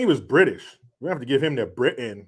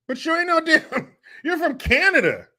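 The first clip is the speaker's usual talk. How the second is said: very high, laughing put-down; high mocking voice cracking into laughs; laughing mock disbelief; 13 semitones higher than usual. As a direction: squeal it through laughter. Mocking non-country singer, breaking into laughter.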